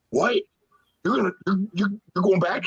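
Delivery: high, breathless "you're going back?"; shocked excitement. Retold or imitated. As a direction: replay hearing the big comeback news, way up. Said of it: "And I was like".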